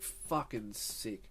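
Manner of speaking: very soft, low, slow aside; hushed private delight. Retold or imitated. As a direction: savour it slowly under your breath. Secret delight at the offer.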